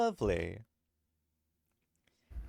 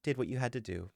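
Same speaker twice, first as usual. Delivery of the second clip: story narration; 3 semitones lower, 8 dB quieter than usual. Acted. GM narrates Squomo's scene.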